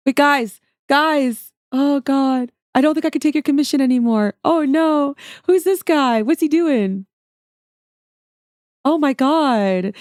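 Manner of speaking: loud, high, hammed-up panic; mock distress. Artist pretending the commission is too much, mock distress. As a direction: wail in feigned overwhelm.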